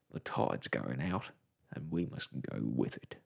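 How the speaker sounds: a big bearded captain refusing flatly; stern captain. A narrator's reading. The captain telling the children he cannot turn back, stern and low. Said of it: "frowned at them and said".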